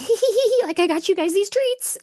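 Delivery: squeaky high giggly voice, then bright and playful; giddy giggle. Retold or imitated. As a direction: giggle like a child sneaking treats to fairies. Giggling while offering fairy snacks.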